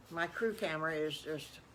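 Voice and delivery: plain reading voice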